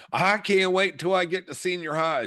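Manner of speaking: put-on high, eager little-girl voice; child's eager voice. Stepdaughter quoted as child.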